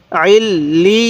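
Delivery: "আইল লি" slow, l doubled and ee drawn out into a y; doubled letters. Shown as a demonstration. Teacher showing learners letters pronounced twice, slow drawn-out joining. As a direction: lean on the double l in "আইল লি", stretching the vowel. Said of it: "ইয়াটাও দুইবার উচ্চারণ হবে".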